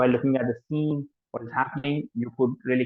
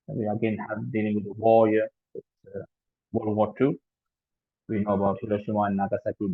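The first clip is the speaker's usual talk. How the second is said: ordinary talk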